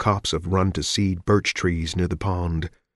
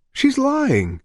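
insisting. Acted. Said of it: "Elton insisted".

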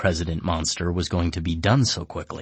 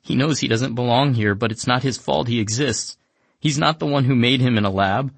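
a touch of tearful pleading, higher; mild mock crying; 6 semitones higher, 4 dB louder than usual. Emika tearfully defends Wajim, mild crying. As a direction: plead through light tears. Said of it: "Emika starts crying and is like".

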